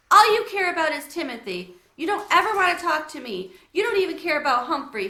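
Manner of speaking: high, loud hurt outburst; cried. Acted. Girl accuses her mother, crying out in hurt. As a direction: lash out tearfully. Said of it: "she cried".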